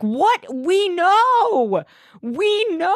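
high, drawn-out mock exasperation; exasperated retort. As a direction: wail it in mock disbelief. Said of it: "And I'm like".

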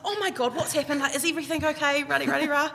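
high-pitched, hurried mock panic; imitating worried mum. Mum asking crying daughter what happened, mock panic.

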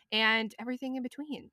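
usual speaking voice

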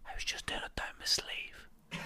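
whispered secret confession; hushed whisper from a woman on a plane. Retold or imitated. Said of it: "She went".

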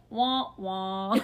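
sad-trombone wah wah into a laugh; mock the letdown, then laugh; deflated mock. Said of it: "She's like".

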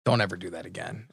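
deadpan it under your breath; quiet scolding. Retold or imitated. Wife scolding him after a bad impression, softly. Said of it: "she goes".

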